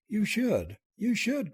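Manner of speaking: high, insistent outburst; a girl's ardent protest, voiced high; passionate cry. A narrator's reading. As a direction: insist with fervour, pitch lifted. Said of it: "cried the girl passionately".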